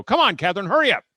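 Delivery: loud, high, impatient urging; mad dad. Retold or imitated. Mad dad hurrying his daughter in the bathroom, impatient. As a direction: re-enact an irritated father's shout. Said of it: "I'm mad".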